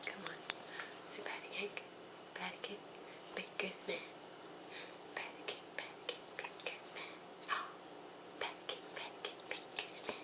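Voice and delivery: soft whispered nursery rhyme; whispering. Acted. Whispered patty cake rhyme.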